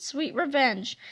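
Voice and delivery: ordinary delivery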